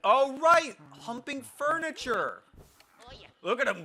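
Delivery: loud, high excited yell. Real, not acted. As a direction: cry it out in delight. Laughing at Luigi's antics.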